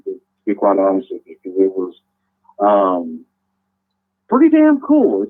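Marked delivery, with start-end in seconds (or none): 4.3-5.2 bursting up high and loud; excited. Real, not acted. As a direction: let it burst out, thrilled.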